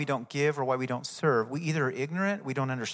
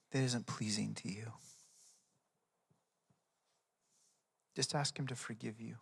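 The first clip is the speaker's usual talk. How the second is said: quiet prayer lead; 3 semitones lower, 6 dB quieter than usual. Real, not acted. Leads congregation in prayer.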